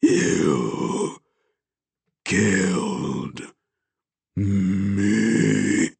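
ghostly rasp, each word drawn out; accusing, rasping dead man; horrible rasping voice. A narrator's reading. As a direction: rasp it out word by word, with long gaps. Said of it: "in a horrible, rasping voice".

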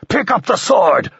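high, loud screamed demand; furious high shout, challenging a duel; screaming. A narrator's reading. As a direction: scream it at him in fury. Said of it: "screamed Bertol".

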